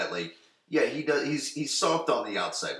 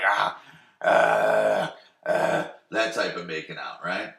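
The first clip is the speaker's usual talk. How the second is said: usual speaking voice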